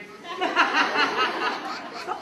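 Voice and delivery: loud, high helpless laugh; real burst of laughter. Real, not acted. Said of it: "It's kind of funny".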